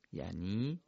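ordinary talk, nothing marked

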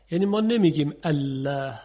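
ordinary delivery

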